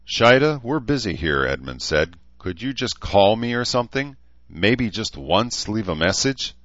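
lightly voiced irritated council leader; chewing out. A narrator's reading. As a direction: scold her for barging in. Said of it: "chewing out a council member".

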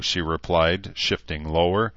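ordinary delivery

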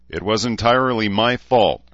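ordinary delivery

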